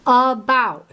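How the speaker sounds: about with the second syllable pushed, slow; stressed about. Shown as a demonstration. Teacher demonstrating the stress in about, slowly stressed. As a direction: lean on the -bout of about. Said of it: "it with more stress".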